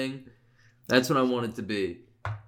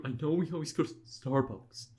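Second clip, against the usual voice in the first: mock proposal; 4 semitones higher, 4 dB quieter than usual. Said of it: "I was doing for, for you".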